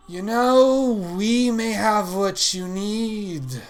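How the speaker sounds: loud, high, drawn-out creepy villain voice; evil uncle calling from the shadows. Acted. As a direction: croon it menacingly, stretching the words. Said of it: "That is evil and creepy".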